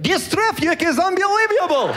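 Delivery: high, thick mock Russian accent; exaggerated comic Russian accent. Shown as a demonstration. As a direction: slip a Y sound into the words, Russian-style. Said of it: "the Russian accent".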